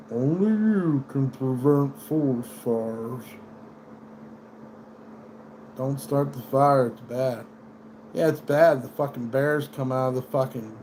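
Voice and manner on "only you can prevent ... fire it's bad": slow, calm mascot voice; mild PSA mascot, slow; gentle Smokey slogan. Retold or imitated. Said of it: "But no, he's like".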